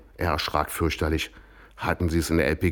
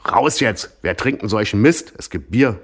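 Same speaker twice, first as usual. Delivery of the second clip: louder, firm and bossy; father laying down the law, raised voice; gruff command; 4 semitones higher, 6 dB louder than usual. Acted. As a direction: order them out like the man of the house. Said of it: "bestimmte der Vater".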